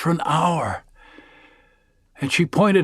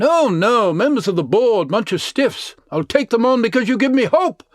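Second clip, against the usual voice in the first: put-on lively older lady; 7 semitones higher than usual. Edith's eager farewell.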